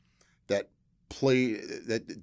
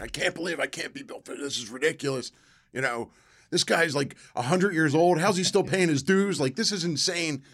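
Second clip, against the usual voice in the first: indignant retold rant, higher and building louder; ranting and raving; 7 semitones higher, 5 dB louder than usual. Actor to a friend about the name rule, ranting and raving. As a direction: rave in exasperated disbelief. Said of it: "ranting and raving".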